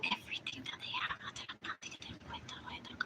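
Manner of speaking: hushed secretive whisper. Real, not acted. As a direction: drop to a whisper, confiding.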